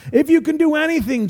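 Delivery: high-pitched quoting back in disbelief; incredulous echo. Acted. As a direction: throw the doubt back at them. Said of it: "Jesus says".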